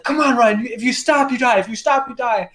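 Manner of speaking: high, insistent retold plea; imitated high-pitched urgent warning; urgent urging. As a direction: urge a fallen companion to keep moving. Retold companion urging him up.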